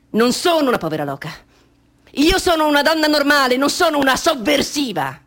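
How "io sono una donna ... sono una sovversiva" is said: high, loud protest; indignant shout. Acted. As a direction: defend yourself fiercely. Protest at police. (protesta gridata, alta e forte)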